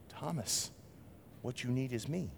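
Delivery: hushed loving reply; gentle Jesus. Retold or imitated. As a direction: quietly point him to yourself. Jesus gently answering Thomas.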